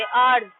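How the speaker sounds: आवर्स with the h dropped, said low; teacher models आवर्स, vowel first; silent h. Shown as a demonstration. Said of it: "एच नहीं बोलोगे, आवर्स बोलोगे". ("आवर्स" ह हटाकर, नीचे स्वर में)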